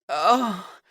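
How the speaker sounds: high sharp cry of pleasure. A narrator's reading. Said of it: "Caden cried out".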